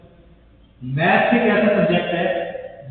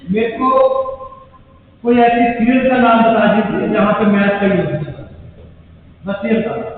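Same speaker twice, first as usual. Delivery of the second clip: loud challenge; 3 semitones higher, 6 dB louder than usual. Real, not acted. Teacher demands an answer from class.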